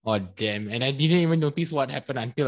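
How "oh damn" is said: ordinary delivery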